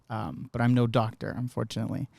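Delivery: normal talking voice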